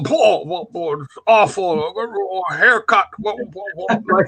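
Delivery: put-on old Liverpool grandad grumbling in an English accent, slowing on haircut; mock-disgusted English accent, sputtering what what what; grumpy English grandfather. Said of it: "mutter in his English accent".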